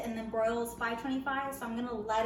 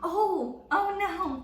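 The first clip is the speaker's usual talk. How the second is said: surprised; 8 semitones higher, 9 dB louder, much slower than usual. Real, not acted. Friend burned by wasabi.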